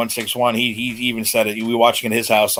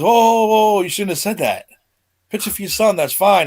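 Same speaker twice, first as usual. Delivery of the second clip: imitating The Rock; 7 semitones higher than usual. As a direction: mimic The Rock brushing off Hogan, pitched up. Rock imitated, louder higher.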